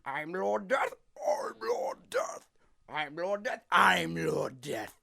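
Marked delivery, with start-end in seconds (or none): 0.0-2.5 strained whispery cartoon voice, climbing high; Lord Death voice test. Voice actor trying Lord Death takes, whispery and strained. 3.7-4.9 slow, louder, drawn-out declaration; Lord Death voice test. Shown as a demonstration. Voice actor trying another take, slow and emphatic.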